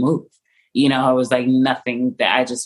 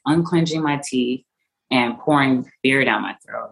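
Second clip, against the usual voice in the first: usual speaking voice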